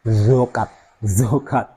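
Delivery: "zokat" with a heavy zo sound; wrong zokat. Shown as a demonstration. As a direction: show the funny mistake "zokat". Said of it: "diucapkan seperti huruf zo".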